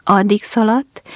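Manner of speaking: ordinary delivery, nothing marked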